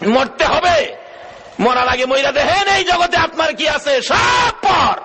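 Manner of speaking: high shouted preaching, peaking on the last words; fervent exhortation. Real, not acted. Preacher warning the crowd of death, high shout. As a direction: drive the warning home at full voice.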